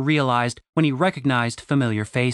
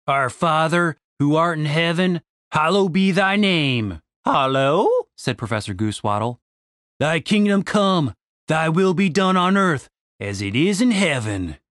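earnest prayer; 2 semitones higher than usual. A narrator's reading. Randy prays aloud.

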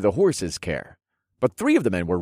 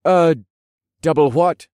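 higher, louder puzzled question; ask, thoroughly puzzled; confused; 9 semitones higher, 8 dB louder than usual. A narrator's reading. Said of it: "furrowed his eyebrows in confusion".

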